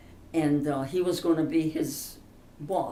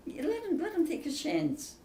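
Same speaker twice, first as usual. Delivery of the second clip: pleading; 10 semitones higher than usual. Real, not acted. Pleading for the boy's chance.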